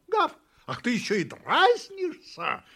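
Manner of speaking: loud, high outraged cry; angry shout. A narrator's reading. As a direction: shout as if being teased. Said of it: "закричала собака". (возмущённый крик)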